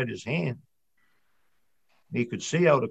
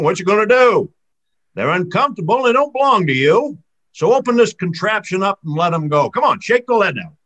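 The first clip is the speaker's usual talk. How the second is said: bossy sea serpent; 6 semitones higher, 9 dB louder than usual. Acted. Serpent bossing Charlie.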